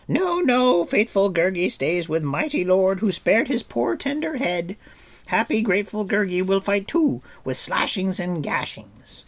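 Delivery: high, loud, pleading creature voice; a whining little beast begging to stay and fight; Gurgi's outcry. Acted. Gurgi begs his lord to let him fight, high pleading outcry. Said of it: "set up a great outcry".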